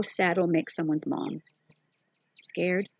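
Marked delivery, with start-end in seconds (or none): none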